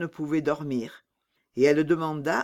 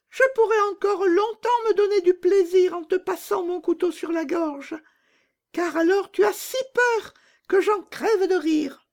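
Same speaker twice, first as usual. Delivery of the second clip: much higher, loud and blunt; bold robber girl; 3 dB louder than usual. Acted. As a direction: toss it off like a fearless bandit girl.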